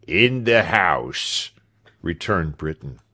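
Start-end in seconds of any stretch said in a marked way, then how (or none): 0.1-1.5 very slow, discontented reply; surly manservant, slow and unceremonious; sour servant. A narrator's reading. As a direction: drag the words out grudgingly. Sour servant answers his master.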